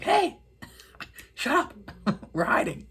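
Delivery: high squeaky half-whisper, dropping to a whisper; mock urgent hush. Hiding boy hushing the girl in the woods, mock urgent hush. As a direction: hush them in a high, playful stage whisper.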